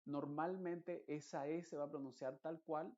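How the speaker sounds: ordinary delivery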